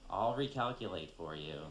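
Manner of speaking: guilt-trip them like a worried grandmother. Acted.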